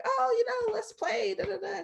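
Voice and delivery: put-on a child at recess asking a classmate to play, in a high sing-song voice; sweet child voice. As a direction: chirp it like a little kid asking to play.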